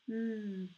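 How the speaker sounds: "M" as a sustained hum; long, even mmm for "M"; isolated M sound. Shown as a demonstration. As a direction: hold "M" with lips closed. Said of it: "Comme ça".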